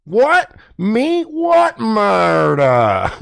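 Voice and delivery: high, drawn-out feigned innocence; high, very slow what murder; guilty playing dumb. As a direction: play the caught killer.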